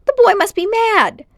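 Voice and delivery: high, loud indignant outburst; scandalised, voice shooting up; outraged uncle. A narrator's reading.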